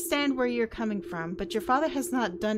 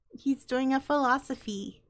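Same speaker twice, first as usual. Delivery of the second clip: ordinary delivery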